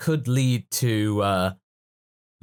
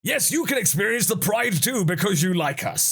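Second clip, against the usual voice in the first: high, hammed-up proud voice; mock-heroic proclamation; mock Saiyan boasting; 7 semitones higher than usual. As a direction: parody a hero granting a fan's wish. Acted Saiyan answer to a fan.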